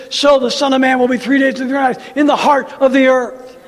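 loud fervent scripture preaching. Acted. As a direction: thunder the verse from the pulpit. Preaching scripture at full force.

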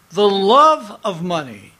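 "love" drawn out and punched; stress on "love". Shown as a demonstration. Preacher corrects a common misquote, holding "love" with strong stress. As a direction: repeat it with the weight all on "love". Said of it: "not money".

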